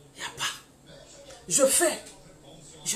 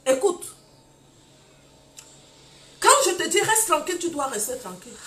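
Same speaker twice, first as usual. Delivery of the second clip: stern husband; 4 semitones higher, 6 dB louder than usual. Retold or imitated. Husband's stern order voiced.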